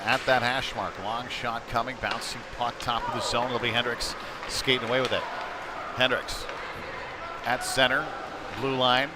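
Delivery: normal talking voice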